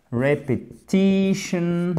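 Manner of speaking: very slow "Repetition", stress on -ti-; slow teacher model of "Repetition"; stressed syllable drill. Shown as a demonstration. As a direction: drag out "Repetition", leaning on the syllable before -tion. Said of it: "l'accentuation, elle est toujours sur la syllabe juste avant".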